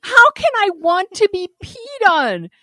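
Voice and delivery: high, squealed disgust; squeamish, high-pitched protest; grossed-out inner voice. Acted. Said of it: "my head is going".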